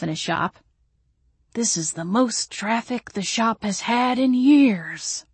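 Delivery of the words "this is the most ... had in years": slow, higher voice with a bright smile; a shopkeeper beaming over the rare customers; smiling brightly. A narrator's reading. Shop owner delighted by visitors, slow beaming voice. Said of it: "smiling brightly".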